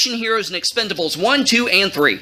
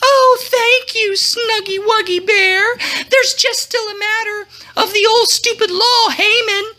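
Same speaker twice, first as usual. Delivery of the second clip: Esther; 10 semitones higher than usual. A narrator's reading. Esther coaxing her husband the king, sugary falsetto. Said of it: "Esther pointed out".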